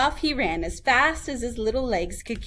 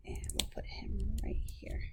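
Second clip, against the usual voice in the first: whispered aside; whispering to herself; 13 dB quieter than usual. Real, not acted. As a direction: breathe it under your voice. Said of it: "aside while placing puppet".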